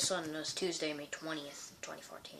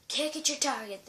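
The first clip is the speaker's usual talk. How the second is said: coach calling out; 9 semitones higher, 5 dB louder than usual. Acted.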